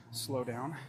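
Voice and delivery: usual speaking voice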